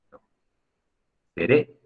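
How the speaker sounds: ordinary delivery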